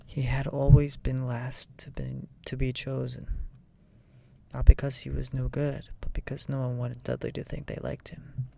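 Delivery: ordinary delivery, nothing marked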